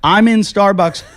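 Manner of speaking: calling out loud and high into an empty shop; mock yell for anyone there; retold yelling. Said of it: "I'm yelling and screaming".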